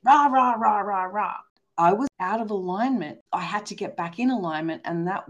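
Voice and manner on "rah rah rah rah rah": mock rant noise. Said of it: "mock ranting noise".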